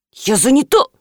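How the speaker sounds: отрезая. A narrator's reading. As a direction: cut the caller off. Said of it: "отрезала Янина". (резко отрезая)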